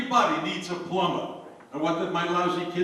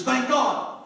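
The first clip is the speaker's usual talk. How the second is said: excited thank god; 8 semitones higher, 3 dB louder than usual. Acted. Rider spurring the horse on with glee, excited. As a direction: thank God said higher and louder. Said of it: "the horse goes faster".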